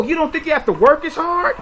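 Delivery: usual speaking voice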